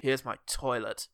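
slow, low mock announcement; deadpan room tour. Keanu shows off his hotel room, deadpan. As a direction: point it out slowly and low.

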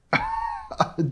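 high, squeaky burst of laughter; short high-pitched giggle; amused laugh. Real, not acted. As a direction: crack up for a moment. Finds the character hilariously funny.

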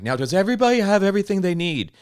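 high, bright imitation of a flustered waitress; high mimicking waitress asking the table. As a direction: chirp it like a busy waitress. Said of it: "She's like".

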